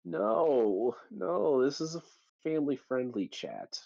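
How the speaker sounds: high, playful scandalized protest; mock protest. Real, not acted. Mock protest at crude joke.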